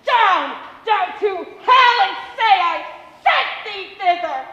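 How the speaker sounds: loud triumphant roar; gloating shout. Acted. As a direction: crow over the dead king. Stabbing again, gloating.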